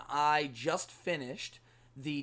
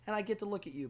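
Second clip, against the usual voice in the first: normal talking voice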